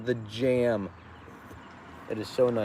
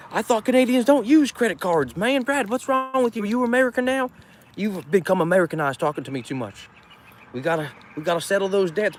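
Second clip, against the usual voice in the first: higher, louder teasing whine; mock outrage; 8 semitones higher, 3 dB louder than usual. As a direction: ham it up scolding Brad. Teasing friend Brad.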